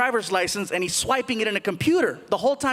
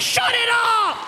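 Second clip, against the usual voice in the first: high, drawn-out plea through hysterics; helpless shout; 12 semitones higher, much slower than usual. Retold or imitated. Cop at window wants music off, helpless shout. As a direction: cry it out high, stretching the words.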